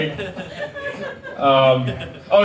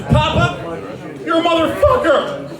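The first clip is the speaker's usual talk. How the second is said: put-on kid voice; 11 semitones higher than usual. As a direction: blurt it out like a proud child. Grandson voiced in story.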